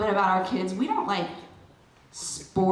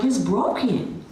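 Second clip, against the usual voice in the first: alarmed; 4 dB louder, slower than usual. Retold or imitated. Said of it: "I was like".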